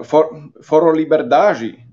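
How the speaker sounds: Foro Liberdade said the Portuguese way, with the end of Liberdade as libeɾdaʒi; slow, careful Portuguese Foro Liberdade, rolled r in Foro; Portuguese pronunciation. Real, not acted. Naming a Brazilian forum to an English-speaking audience, with a Portuguese pronunciation. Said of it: "Sorry for my bad accent".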